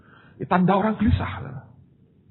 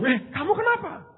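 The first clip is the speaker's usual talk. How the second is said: high, puzzled voice of the wife. Retold or imitated. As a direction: ask it like a worried wife, pitched up. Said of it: "Istri saya bingung". (istri bertanya heran dengan suara tinggi)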